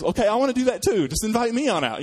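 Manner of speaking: high, loud and keen to jump in; mock eager Peter begging to join. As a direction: play it like an overeager kid volunteering. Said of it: "By the way, is".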